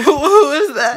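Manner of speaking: loud surprised shout. Acted. Sketch voice, loud far.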